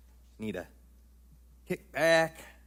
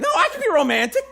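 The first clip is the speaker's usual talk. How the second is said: whiny plea; 8 dB louder, faster than usual. Acted. Husband insists he's romantic.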